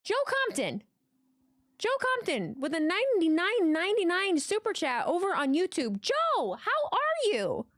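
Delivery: high-pitched, thrilled exclaiming; real excited shout-out. Real, not acted. Streamer thanking a viewer for a big super chat, high and thrilled. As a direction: squeal the greeting with delighted surprise.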